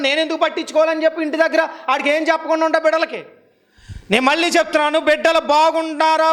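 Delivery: high-pitched, sustained sermon delivery; urgent exhortation held high; forceful preaching. Real, not acted. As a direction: preach it out, driving each clause home.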